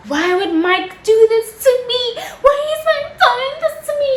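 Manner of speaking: very high-pitched, hammed-up wailing; hysterical wail. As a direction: wail it out like a dramatic breakdown. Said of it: "I'm hysterical".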